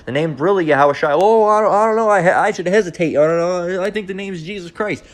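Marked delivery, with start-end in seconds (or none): 1.1-5.0 higher, wavering doubter's voice; mock hesitant churchgoer. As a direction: imitate someone dithering over what to believe. Mocks hesitant churchgoer doubting the name.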